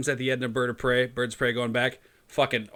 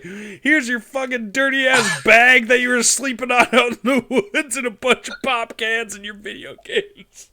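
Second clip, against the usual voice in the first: slow, loud and cracking up; half shout it through laughter; loud laughing mock rant; 10 semitones higher, 9 dB louder, slower than usual. Friend mocking the parents' gift, laughing loud rant.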